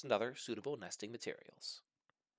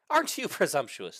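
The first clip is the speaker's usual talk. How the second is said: higher, louder mock scoff; 6 semitones higher, 6 dB louder than usual. Rhea scoffing at Herbert's presumption, raised and louder. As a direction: scoff at his boldness. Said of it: "the female scoffed".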